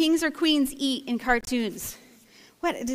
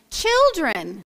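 calling from shore. Acted. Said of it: "they hear a voice from the shore".

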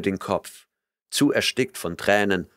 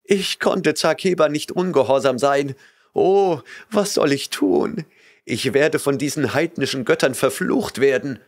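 put-on frightened girl; 6 semitones higher, 3 dB louder than usual. A narrator's reading. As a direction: plead with trembling fear. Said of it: "Sie flehte und zitterte vor Angst".

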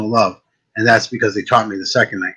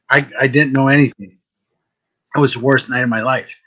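usual speaking voice

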